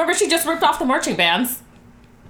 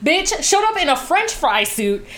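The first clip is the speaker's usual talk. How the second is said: mocking; 7 semitones higher than usual. Mocking [name]'s song.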